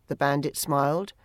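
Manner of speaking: ordinary delivery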